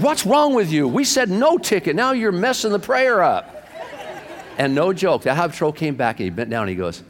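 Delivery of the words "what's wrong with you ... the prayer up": very high-pitched mock wifely outburst; exasperated scolding. Wife scolds husband for spoiling her prayer, exasperated. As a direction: imitate a wife telling him off.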